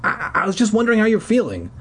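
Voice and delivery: high, fast nervous rush; stammering. Retold or imitated. Sam calling the sick girl, nervous stammer. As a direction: trip over the words nervously. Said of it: "I stammered".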